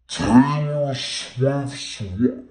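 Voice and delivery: very slow, loud, distorted repeat; drawn-out mock shock. Reviewer repeating the [name] question, loud slow-motion disbelief. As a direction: stretch every word out in slow motion, loudly.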